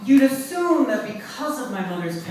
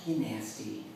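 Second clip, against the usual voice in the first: quiet put-down; 5 semitones lower, 10 dB quieter than usual. Retold or imitated. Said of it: "looked the woman straight in the face".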